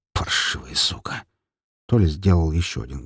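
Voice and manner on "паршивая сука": hoarse bitter whisper; whispered curse. Acted. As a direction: spit it out under your breath. Bitter curse under breath.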